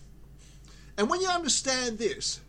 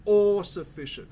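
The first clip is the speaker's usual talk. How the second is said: "all" stretched and loud in "all sufficient"; emphatic, loud "all" before "sufficient"; stress on ALL; 5 dB louder than usual. Real, not acted. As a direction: hit "all" hard to drive the term home. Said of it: "I want to stress that word".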